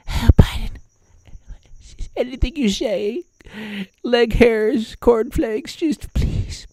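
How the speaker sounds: very high, small pleading voice; mock coddling media. Media begging the president to say anything, high mock plea. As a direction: coax him along in a tiny falsetto. Media coaxing Biden to speak.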